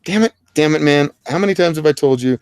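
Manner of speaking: loud, high-pitched mock exasperation; mock scolding. Mock scolding over bad Wi-Fi.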